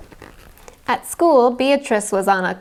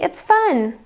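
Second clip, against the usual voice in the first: higher, bright coaxing; cheerful; 8 semitones higher than usual. A narrator's reading. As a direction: coax her to join. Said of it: "said Sarah".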